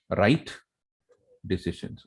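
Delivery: normal talking voice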